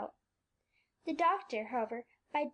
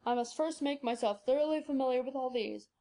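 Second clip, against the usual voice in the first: put-on zealous old doctor; 2 semitones lower than usual. Doctor plans his study.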